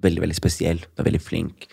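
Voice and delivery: soft, hushed words of a dying man, lightly voiced; a hint of a frail uncle speaking quietly. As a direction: murmur it gently like a weak uncle in bed. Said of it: "så sier han til meg".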